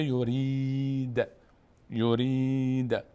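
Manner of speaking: یورید drawn out slowly on a long "reee", ending in a bouncing bare d; recitation stop on sukun. Shown as a demonstration. Teacher shows how it is recited, final sukun. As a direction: hold the ee of یورید, then close on d without vowel.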